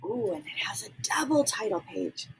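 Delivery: soft breathy whisper over the book. Real, not acted. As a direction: whisper it like sharing a secret. Reading a picture book softly.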